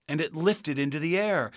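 plain reading voice

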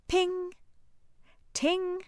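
high, bright chime sounds; magic ping. Acted.

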